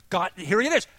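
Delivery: loud, high, amazed proclamation; excited exclamation. Real, not acted. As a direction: shout the incredible news. Excitedly announces God texted him.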